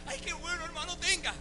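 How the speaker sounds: tiny high-pitched, soft falsetto gush; hammed-up gladness in a small squeaky voice; mock eagerness. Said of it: "No fue que salí corriendo y dije". (entusiasmo fingido en falsete suave)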